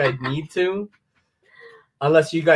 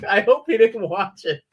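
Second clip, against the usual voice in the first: ordinary delivery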